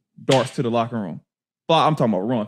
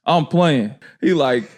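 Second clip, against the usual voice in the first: ordinary talk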